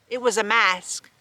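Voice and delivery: ordinary delivery, nothing marked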